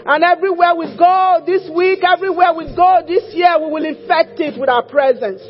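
loud fervent declaring prayer; preaching prayer. Acted. Preaching prayer at full force.

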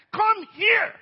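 high, explosive command; angry shout. Retold or imitated. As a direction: bellow it as a furious order. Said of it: "I got very angry".

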